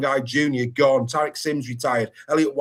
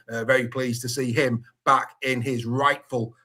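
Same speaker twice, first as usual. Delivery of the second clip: normal talking voice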